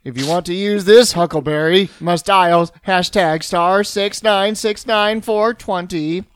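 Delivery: slow, loud automated phone voice; robot voice. Pretend. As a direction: read the code like an answering machine. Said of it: "says it with a robotic voice".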